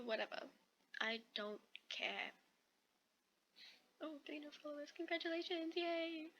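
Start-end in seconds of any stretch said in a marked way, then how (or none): 4.0-6.3 high-pitched little cheer; little celebration. Pretend. Reader congratulates on three new followers, high playful cheer. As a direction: pitch it up for a small, playful yay.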